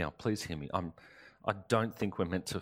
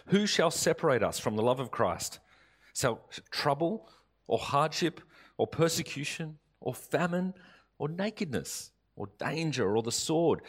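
raised, declaiming Bible reading; scripture reading; 3 semitones higher, 4 dB louder than usual. Acted. Preacher reading Romans eight aloud to the church, declaiming. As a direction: proclaim the verses to the congregation.

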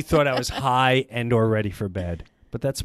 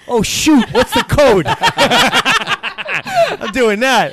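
loud, high, excited through laughter; giddy, laughing and raised voice; laughing outburst; 8 semitones higher, 7 dB louder than usual. Real, not acted. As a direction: crack up while exclaiming. Laughing over the free offer.

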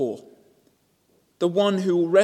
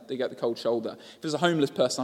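normal talking voice

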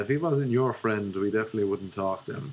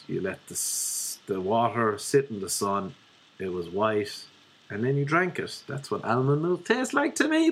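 ordinary talk

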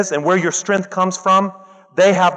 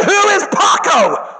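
much higher, loud, slow incredulous yell; baffled shout; 13 semitones higher, 4 dB louder, slower than usual. Retold or imitated. Teacher shouting in disbelief at the crying girl. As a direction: shout it in total bewilderment.

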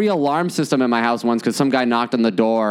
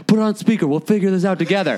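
mom taking charge, pitched up; a pushy mom grabbing the call; bossy mom; 7 semitones higher, faster than usual. Retold or imitated. Mom tells her son to put the bank on speaker, pitched-up take-charge voice. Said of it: "my mom is like".

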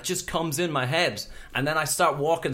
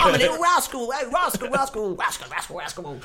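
mock rascal chant; playful rascal voice; 9 semitones higher than usual. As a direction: taunt like a cheeky imp. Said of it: "what an English rascal sounds".

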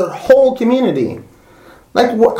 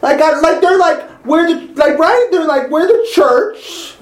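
higher, heated rant; pissed off; 10 semitones higher than usual. Real, not acted. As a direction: let the anger push the voice up. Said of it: "It's like, pissing me off so hard".

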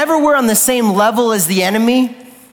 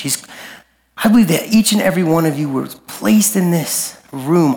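lower, unhurried invitation to prayer; reflective altar call; 4 semitones lower than usual. Real, not acted. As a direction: gently lead them into reflection, leaving long pauses. Quiet closing reflection, eyes closed.

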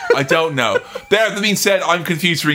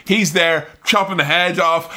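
ordinary talk

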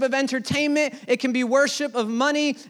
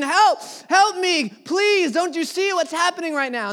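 higher and louder desperate calls; retold scream for help; 7 semitones higher, 3 dB louder than usual. Dreamer screaming to his friends in the next room, loud and desperate. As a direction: cry out for help as if being beaten. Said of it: "I'm screaming".

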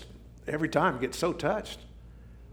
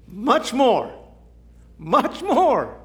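MORE driven high and loud; emphatic; 9 semitones higher, 4 dB louder, much slower than usual. Real, not acted. Preacher stressing "Much more" to the congregation. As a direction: hammer "Much more" home.

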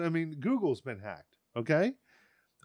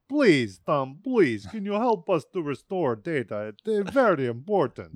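loud pleading Russian client, imitated; begging in a light put-on Russian accent; recalling Russian clients, begs Tom in a mock Russian accent; 4 dB louder than usual. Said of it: "that was always the".

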